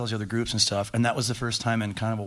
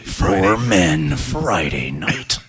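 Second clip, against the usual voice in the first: low, slow trailer announcer; movie trailer voice; 4 semitones lower, 6 dB louder, much slower than usual. Pretend. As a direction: drag it out like an epic teaser. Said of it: "That's my movie voice".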